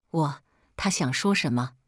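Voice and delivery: usual speaking voice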